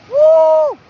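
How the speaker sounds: high, loud cheering whoop; a real high celebratory woo; excited whoop. Real, not acted. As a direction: whoop for the donor. Cheering a donor shout out.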